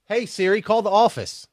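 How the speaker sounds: ordinary delivery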